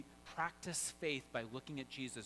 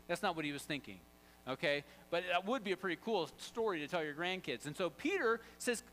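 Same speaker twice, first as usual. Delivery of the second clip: ordinary talk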